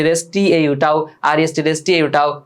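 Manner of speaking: normal talking voice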